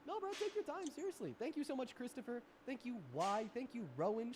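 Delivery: high, gushing thank you; excited thanks. Real, not acted. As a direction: bubble over with thanks.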